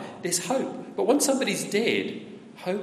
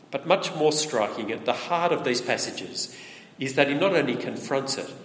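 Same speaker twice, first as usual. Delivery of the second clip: ordinary talk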